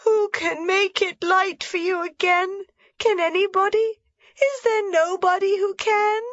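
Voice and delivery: put-on high, tearful child's cry; tearful pleading. A narrator's reading. Heidi begging grandmother, high and tearful. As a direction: plead through tears like a small girl. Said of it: "burst into floods of tears and loud sobs".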